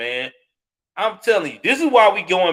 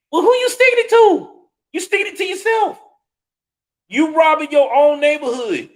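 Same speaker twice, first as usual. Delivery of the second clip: incredulous rant; exasperated outburst; 9 semitones higher than usual. Real, not acted. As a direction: shoot the question back in outraged disbelief. Angry rant at rioters.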